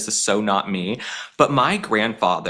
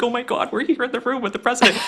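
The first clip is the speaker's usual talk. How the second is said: giddy excitement; 11 semitones higher, faster than usual. Retold or imitated. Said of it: "I'm like".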